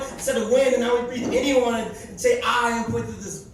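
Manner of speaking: loud, high preaching delivery; fervent spoken word sermon; preaching poem. Acted. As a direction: preach it to the room.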